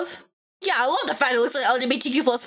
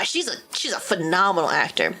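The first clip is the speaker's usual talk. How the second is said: ordinary talk, nothing marked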